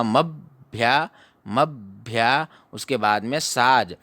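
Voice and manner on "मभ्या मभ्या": मभ्या slow with weight on the भ्या; teacher stressing भ्या in मभ्या; slow stress demo. Shown as a demonstration. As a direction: lean into भ्या in मभ्या, slowly. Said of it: "बल दिया जाएगा".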